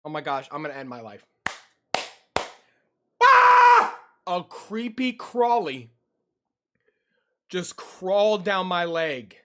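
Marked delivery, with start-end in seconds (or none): 3.2-4.0 sudden piercing shriek; frightened scream. Real, not acted. Bug crawls down leg. 4.3-9.4 disgusted panic. Real, not acted. Bug just crawled on leg.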